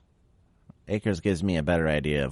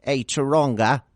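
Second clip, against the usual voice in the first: higher, hammed-up attempt at an Australian accent; a clumsy mock Australian accent with a raised pitch; bad Australian accent; 7 semitones higher, 3 dB louder than usual. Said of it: "I don't have a very good Australian accent".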